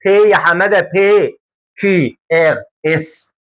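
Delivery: usual speaking voice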